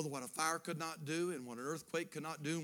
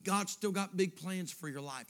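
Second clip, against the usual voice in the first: ordinary talk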